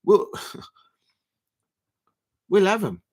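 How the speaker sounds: whispered with a laugh; sly whisper, half laughing; imagined manager's thought. Retold or imitated. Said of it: "he's thinking".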